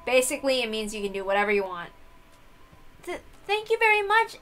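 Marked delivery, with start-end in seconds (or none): 3.0-4.4 high character voice. Acted.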